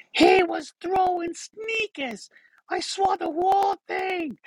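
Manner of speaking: high, slow, hammed-up Boston fan; thick Bostonian accent. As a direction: screech it out in exaggerated Bostonian. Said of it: "with this really thick Bostonian accent".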